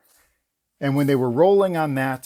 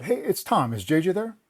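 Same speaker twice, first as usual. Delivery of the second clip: lightly voiced casual caller, higher; a touch of a friendly star voice; mild imitation of the star asking for his boss; Tom Cruise on phone; 6 semitones higher than usual. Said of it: "This voice goes".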